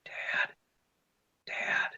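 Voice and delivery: put-on faint whispered call of a child; barely voiced, urgent little whisper. Child waking dad at night, hushed whisper.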